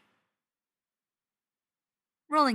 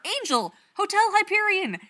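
fanboy gushing; 12 semitones higher, 8 dB louder than usual. Acted. Stiles gushes about the show.

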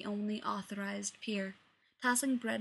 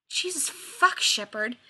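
loud, furious outburst, higher; unbelievably angry; 4 semitones higher, 7 dB louder, slower than usual. A narrator's reading. Rodney to Shepard in the brig, furious outburst. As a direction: explode at him in fury. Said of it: "he said, unbelievably angry".

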